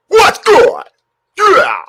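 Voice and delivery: high, loud hype; celebrating yell. Real, not acted. Streamer celebrating the made shot. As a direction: crow over the shot.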